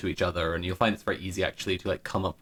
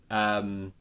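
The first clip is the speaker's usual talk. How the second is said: ordinary talk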